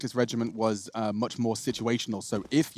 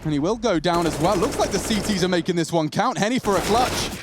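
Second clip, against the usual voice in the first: raised, driving esports caster voice; live play-by-play; 7 semitones higher, 5 dB louder than usual. Real, not acted. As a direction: call the kills as they happen. Calls live esports round.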